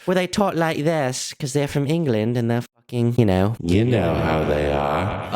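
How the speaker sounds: slow, hammed-up posh English accent; mock English accent. Mocking English people after the interviewee names England, in a slow English accent. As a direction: put on an English accent to mock how they sound.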